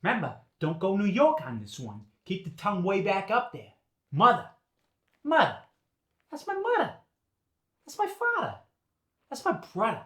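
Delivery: Boston dialect, final R dropped, tongue far back; R-dropping Boston accent. Shown as a demonstration. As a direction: drop the R in "Mother", "father", "brother", Boston style. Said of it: "When we drop the R".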